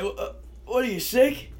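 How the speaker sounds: high-pitched retold dad calling from his room. Son imitating dad hearing him retch, calling out a question. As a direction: imitate a puzzled dad checking in from another room.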